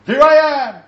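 booming, high self-announcement; grand announcement. Retold or imitated. Samaritan visitor announcing himself at the door, loud and high. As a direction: proclaim yourself at the door like a herald.

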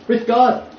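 With God punched high and loud; loud, high insistence on With God; emphatic correction. Shown as a demonstration. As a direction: hit With God hard.

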